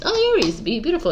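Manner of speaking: very high, fond little coo; affectionate exclamation. Real, not acted. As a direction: leap way up high, as if adoring them. Fondly hailing the sign being read.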